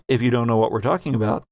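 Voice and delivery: ordinary talk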